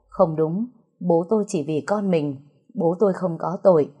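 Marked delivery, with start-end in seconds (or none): none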